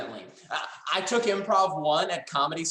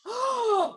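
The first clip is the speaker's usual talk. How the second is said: very high, sharp melodramatic gasp; theatrical gasp; hammed-up gasp. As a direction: gasp in mock horror. Acted shock.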